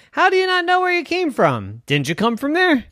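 squeaky playful scolding; high teasing walrus. Acted. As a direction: tease her, high and bright.

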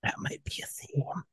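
very soft, voiceless whisper; hushed, whispered comment; whispered aside. Real, not acted. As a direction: slip it in under your breath. Hushed wry aside to co-host.

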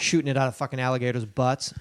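usual speaking voice

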